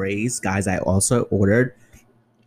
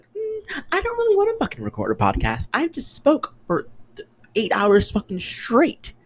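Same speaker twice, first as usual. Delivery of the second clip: much higher, whining put-upon voice; whiny exhausted complaint. Retold or imitated. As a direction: whine it like you're drained after a long shift. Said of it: "I'm like".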